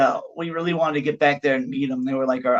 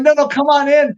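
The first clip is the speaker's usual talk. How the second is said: eager invite; 11 semitones higher, 7 dB louder than usual. Retold or imitated. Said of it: "I'm like".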